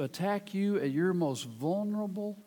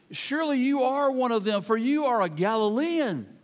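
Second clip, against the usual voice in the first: accusing bystanders; 6 semitones higher, 4 dB louder than usual. Acted. Bystanders accuse Peter in reading.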